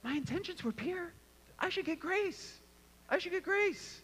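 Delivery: high, soft, put-on pleading voice; self-justifying whine. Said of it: "I instantly tell myself".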